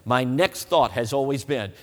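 ordinary talk

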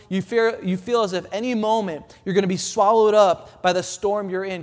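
usual speaking voice

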